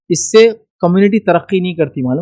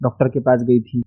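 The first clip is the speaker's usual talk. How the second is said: normal talking voice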